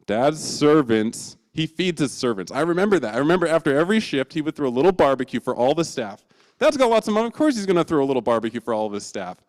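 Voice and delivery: the hungry son lighting up at a plan; excited realization. Acted. Son remembering dad's barbecues, eager and excited.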